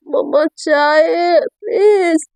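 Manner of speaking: slow, drawn-out whining call downstairs; whiny call for tea. Acted. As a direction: stretch it out like a sleepy girl begging for tea. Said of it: "बोलती है".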